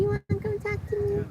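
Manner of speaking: very high, soft cooing "You wanna come talk to me?". Real, not acted. To her pet bird, asking again in high cooing baby talk.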